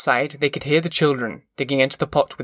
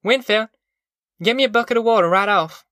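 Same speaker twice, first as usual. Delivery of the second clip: put-on high, brisk call to children; mother summoning with an order; 8 semitones higher, 3 dB louder than usual. A narrator's reading. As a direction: call out an errand quickly, pitched way up. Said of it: "she called".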